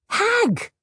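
high, loud shout. A narrator's reading. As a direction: yell it out in alarm. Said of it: "Lena shouted".

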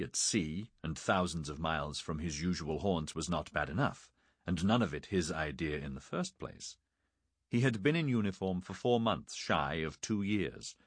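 ordinary delivery

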